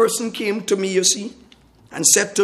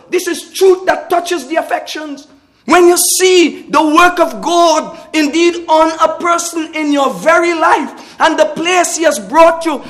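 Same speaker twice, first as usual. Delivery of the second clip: higher and louder, driving each line home; fervent preaching; 6 semitones higher, 6 dB louder than usual. Real, not acted. Preacher stirring the saints about God's grace, fervent. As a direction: preach it with rising conviction. Preacher rising to full force.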